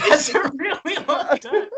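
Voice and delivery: very high, squeaky disbelief; incredulous. Real, not acted. Reacting to friend's microwave dare answer, high-pitched disbelief. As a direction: protest in astonishment, pitch way up. Shocked at the proposed time.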